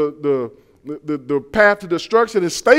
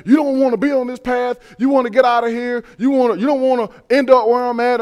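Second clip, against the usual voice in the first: mild tough-talk imitation, pitched up; retold hardened criminal; 6 semitones higher, 3 dB louder than usual. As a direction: lightly act out a hardened convict's warning. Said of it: "talk to 'em bad".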